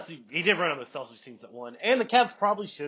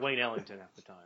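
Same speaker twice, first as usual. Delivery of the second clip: usual speaking voice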